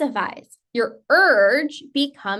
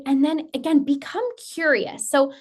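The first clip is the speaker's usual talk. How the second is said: ordinary talk, nothing marked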